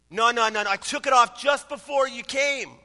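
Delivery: acted husband loudly defending himself; emphatic protest. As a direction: protest your innocence to the officer. Husband hastily protests to officer.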